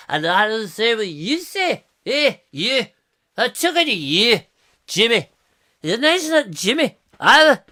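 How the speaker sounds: high, loud comic Scottish voice; broad mock Scottish, slurring like one drink too many; hammed-up Scottish accent. Host playing a Scot answering a viewer, mock Scottish accent. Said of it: "Scottish people do talk like that".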